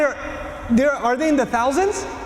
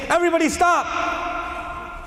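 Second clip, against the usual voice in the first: higher, slow, drawn-out call; mock call to stop; 8 semitones higher, slower than usual. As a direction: stretch it out over a crowd. Someone shouting at a crowd.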